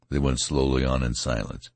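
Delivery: plain reading voice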